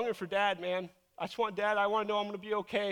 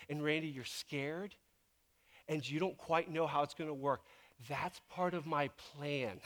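patient reassurance; 5 semitones lower than usual. Retold or imitated. God telling a scared pastor to trust, low and patient. Voices God's patient answer.

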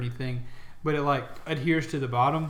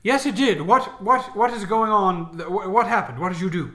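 alarmed; 5 semitones higher, 8 dB louder than usual. Acted. NPC reacts in alarm.

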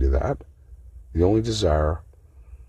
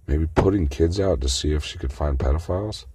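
normal talking voice

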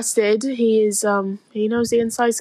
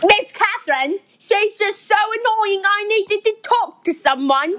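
higher, complaining Yorkshire servant; thick Yorkshire accent; 6 semitones higher than usual. Acted. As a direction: grumble in broad Yorkshire. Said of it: "That is one thick Yorkshire accent".

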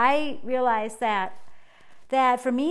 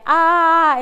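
exasperated ah; 5 semitones higher, 11 dB louder than usual. Retold or imitated. Dog pooped at wrong time.

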